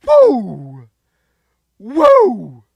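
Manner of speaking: loud celebratory whoops; cheering; cheering woo. Real, not acted. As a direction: whoop like a fan in the stands. Celebrating the pick.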